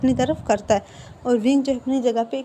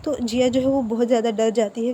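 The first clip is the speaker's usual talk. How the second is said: plain reading voice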